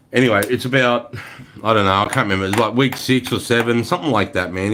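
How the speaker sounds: ordinary delivery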